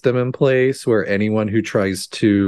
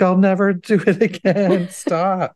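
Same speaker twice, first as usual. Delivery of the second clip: high-pitched pretend begging; mock pleading; 11 semitones higher than usual. Acting out Mona begging the wind, mock pleading. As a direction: plead in a much higher put-on voice.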